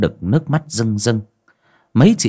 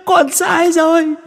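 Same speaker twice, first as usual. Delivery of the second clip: far higher, slow drawn-out plea; pleading ghost wail; 4 dB louder, slower than usual. Acted. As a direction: drag out the begging. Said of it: "mà rú lên".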